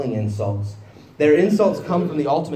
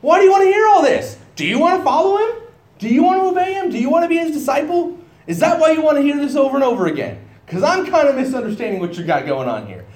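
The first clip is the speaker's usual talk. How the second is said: much higher and louder, fast mock-innocent questions; sarcastic mocking; 13 semitones higher, 6 dB louder, faster than usual. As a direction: needle them with fake curiosity. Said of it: "He gets a little bit sarcastic with them".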